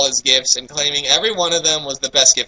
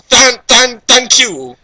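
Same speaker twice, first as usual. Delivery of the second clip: mock excited thanks; 7 semitones higher, 5 dB louder, much slower than usual. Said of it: "echoing the ecstatic thanks".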